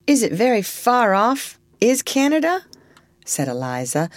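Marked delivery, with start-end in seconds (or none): 0.1-2.7 high, hopeful question; simple, trusting. A narrator's reading. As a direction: ask trustingly, lifting at the end. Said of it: "in a simple, trusting way".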